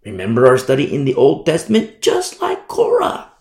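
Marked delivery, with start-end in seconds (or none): 2.0-3.3 high, slow mocking sing-song on "Korah"; a jeering, much higher mock of the rebel's claim. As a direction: sneer the name up high and drawn out.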